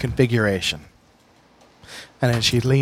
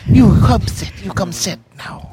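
put-on old hag; 6 semitones higher, 5 dB louder than usual. Crone voice in the fortune scene.

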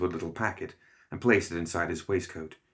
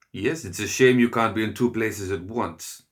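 ordinary delivery

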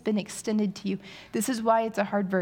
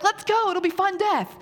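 much higher, laughing cheerful call; husband cheering her on, laughing, much higher; mock eager husband; 11 semitones higher, 4 dB louder than usual. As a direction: toss it off laughing, gung-ho. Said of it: "And he's like".

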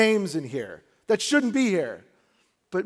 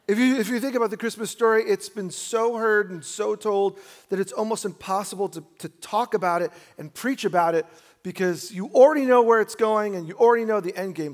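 preaching; 4 semitones higher, 4 dB louder than usual. Acted. Preaching with rising force.

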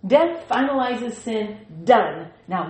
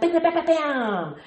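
mock punch sounds; 6 semitones higher than usual. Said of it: "good with my sound effects".

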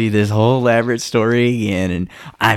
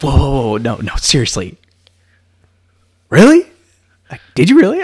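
high, loud shocked disbelief; surprised disbelief; 6 semitones higher, 8 dB louder than usual. Retold or imitated. Friend hearing about the giant buck, loud high disbelief. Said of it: "And you're like".

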